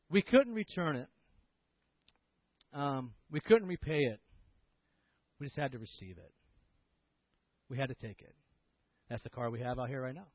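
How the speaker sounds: normal talking voice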